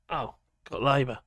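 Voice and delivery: ordinary delivery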